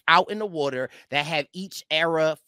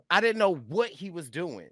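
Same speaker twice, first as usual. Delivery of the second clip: ordinary talk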